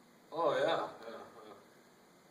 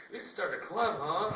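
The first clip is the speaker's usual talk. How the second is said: tall woman voice; 9 semitones higher, 8 dB louder than usual. Retold or imitated. Tall woman voiced, loud high.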